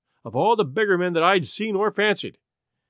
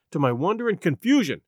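usual speaking voice

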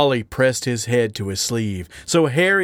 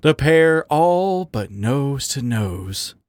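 usual speaking voice

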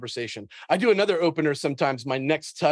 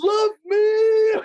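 mock wail; 6 dB louder than usual. Mock begging echo of host.

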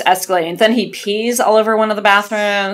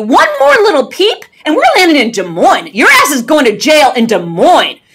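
higher and louder, a strict teacher laying down the law; stern teacher voice; 7 semitones higher, 4 dB louder than usual. Retold or imitated. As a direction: command him like a fed-up high school teacher. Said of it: "in my best teacher voice".

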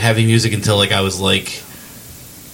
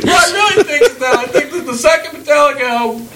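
high mock voice.

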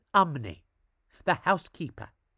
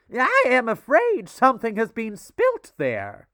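prim lady; 7 semitones higher, 7 dB louder than usual. A narrator's reading. Lady notices stain, voiced.

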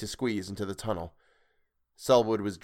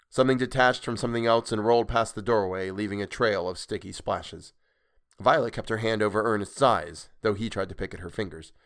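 usual speaking voice

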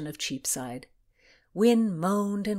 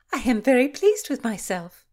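high, loud self-satisfied delight; pleased with herself; 6 semitones higher, 8 dB louder than usual. Acted. Philomena shows off her hiding place, pleased with herself. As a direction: announce it proudly, pitched up. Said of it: "she said".

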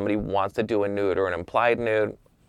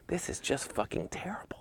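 hushed, mostly whispered dismay; muttered thought; 9 semitones higher, 11 dB quieter than usual. Retold or imitated. Photographer to himself behind the monitor, hushed dismay. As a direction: whisper it privately under your breath.

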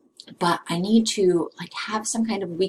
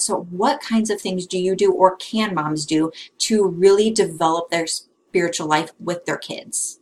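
ordinary delivery

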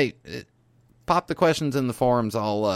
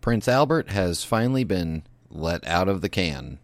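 hijacked broadcast; 4 semitones lower than usual. Acted. Mock news bulletin interrupting show.

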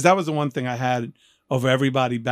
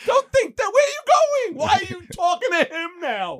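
frantic pleading. Retold or imitated. Young rapper begging his date not to leave. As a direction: plead frantically, voice cracking high. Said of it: "I was like".

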